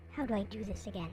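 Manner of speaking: ordinary talk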